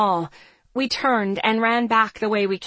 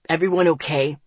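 low-pitched male character voice; firm, low check-in; demanding; 5 semitones lower, 3 dB louder, faster than usual. A narrator's reading. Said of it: "Zeke demanded".

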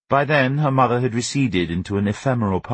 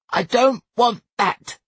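put-on high, slow child's protest shout; stroppy small boy, very high pitched; Sonny; 3 dB louder, slower than usual. A narrator's reading. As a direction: refuse word by word in a high tantrum voice. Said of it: "he shouted".

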